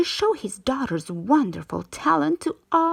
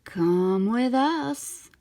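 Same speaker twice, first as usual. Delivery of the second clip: slow, sweet, luring coax; honeyed slow invitation from sly eels; coaxing sweetly; much slower than usual. A narrator's reading. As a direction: draw her in slowly and sweetly. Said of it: "they coaxed sweetly".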